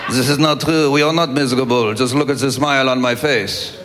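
low, miserable French deadpan; French accent. Acted. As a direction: glum French accent, annoyed and joyless. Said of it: "And that French accent".

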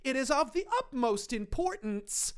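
high, overwrought mock declaration of love; gushing love tirade. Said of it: "Holly goes on a tirade about how much she loves".

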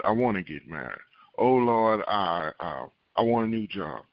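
usual speaking voice

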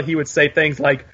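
ordinary talk, nothing marked

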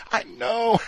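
high "I know" into a cackle; mock cackling agreement. Imitating Ashley tickled by her own story, cackling. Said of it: "And she started cackling".

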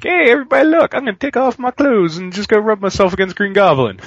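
high, loud call to a crowd; self-mocking boast, pitched well up; mock announcement. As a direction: call everyone over in a silly high voice. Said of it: "'Cause I was like".

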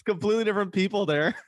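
high-pitched, amused retelling through laughter; laughing recollection. Real, not acted. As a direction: tell it while cracking up. Cracking up over shared memory.

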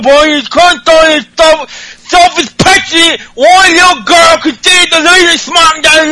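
Becky Lynch ranting at Alexa, garbled high shout; unintelligible Becky Lynch impression. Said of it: "make her unintelligible".

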